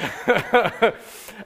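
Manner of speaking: loud laugh after hamming it up. Real, not acted. Preacher laughing at his TV preacher parody, amused. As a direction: burst out laughing at your own parody.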